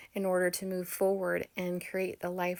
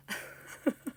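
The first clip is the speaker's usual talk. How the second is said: quiet breathy chuckle; little amused laugh; soft laugh; 4 dB quieter than usual. Real, not acted. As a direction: laugh softly to yourself. Laughs at her own story.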